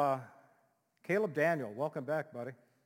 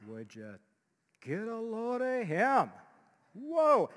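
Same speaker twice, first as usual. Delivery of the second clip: put-on higher, slow, dazzled girlish voice rising to a squeal; swooning girls; 7 semitones higher, 4 dB louder, slower than usual. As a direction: gush over a handsome guy. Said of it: "one of them says".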